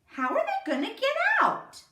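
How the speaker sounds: put-on worried kid pointing it out; little boy. A narrator's reading. Little boy asking how they'll escape, high child's voice. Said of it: "the little boy said".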